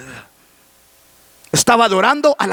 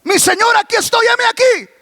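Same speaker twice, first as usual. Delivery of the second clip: lightly acted eager answer, pitched higher; eager young servant; 11 semitones higher than usual. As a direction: answer the master's call, higher and projected. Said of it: "Viene Samuel corriendo". (respuesta solícita de Samuel, en tono alto)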